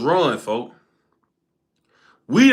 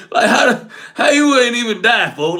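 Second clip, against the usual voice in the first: laughing through the question; cracking up while asking, in disbelief; 3 semitones higher, 3 dB louder than usual. Real, not acted. Telling friends how his buddy survived, laughing.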